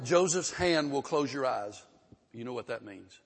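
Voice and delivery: ordinary talk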